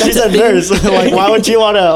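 amused disbelief, voice lifted by laughter; laughing protest. Real, not acted. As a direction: object while cracking up. Laughing protest at dad's suggestion.

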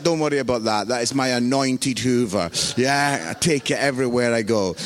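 mock nonchalant. Preacher explaining the fallen Hoover, mock-solemn cool. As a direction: drop deep and play it cool.

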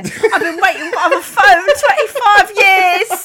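high, slow, laughing lament; hammed-up heartbroken. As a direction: drag it out like soap melodrama. Acting jilted lover bit.

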